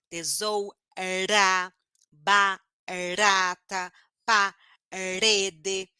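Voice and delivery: TESOURA, BARATA, PAREDE very slowly, tapped R set apart; weak R drawn out. Shown as a demonstration. Teacher isolating the R between two vowels, slow tap. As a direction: split each word before its tapped R. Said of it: "quando a letra R está no meio da palavra".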